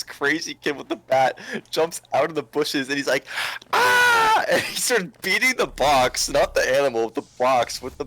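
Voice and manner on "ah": high mock war cry, louder; battle yell. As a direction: leap out screaming a charge. Crazy kid jumps out with bat.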